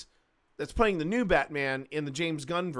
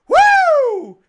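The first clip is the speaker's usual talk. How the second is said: high loud whooping laugh; high giddy whoop of laughter; 16 dB louder than usual. Real, not acted. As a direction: squeal and whoop with delight. Swooning over the scene.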